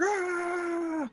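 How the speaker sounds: high drawn-out battle cry; mob war cry. Acted. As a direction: roar as you charge in. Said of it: "they're just like".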